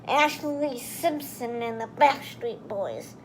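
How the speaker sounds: ordinary delivery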